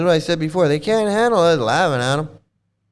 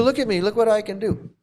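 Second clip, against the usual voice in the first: high, preening mock guru voice, pitched well up; mocking self-important guru; 6 semitones higher than usual. Mocking the guru who thinks he is powerful, in a high, preening voice. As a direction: show off like a smug guru who loves himself. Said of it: "He thinks he's this powerful guru".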